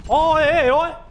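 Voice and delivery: high loud frantic yelps; panicked yelps. Real, not acted. Gamer yelping in panic as enemies shoot him. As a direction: yelp in panic as you get shot.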